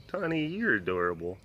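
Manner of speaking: usual speaking voice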